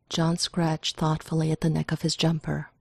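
plain reading voice

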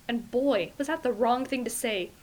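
usual speaking voice

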